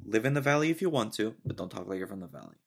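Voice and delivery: normal talking voice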